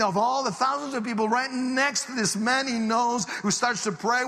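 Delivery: high, loud preacher's climax; impassioned preaching. Real, not acted. Preacher telling the crowd of a baptism, building to a shout.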